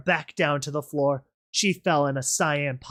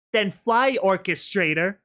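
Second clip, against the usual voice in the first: high, loud dare; shouted taunt; 5 semitones higher, 4 dB louder than usual. Acted. Rainbow Dash daring the rebel to fly, shouting. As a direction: shout it over the commotion. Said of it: "she shouted".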